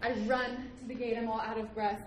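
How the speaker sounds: ordinary delivery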